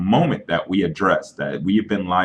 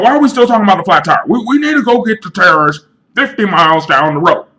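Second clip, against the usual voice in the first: very high, very loud yelling; hammed-up furious screaming fit; high screaming rant; 14 semitones higher, 7 dB louder than usual. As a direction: shriek him into silence. Crazy friend screams shut up.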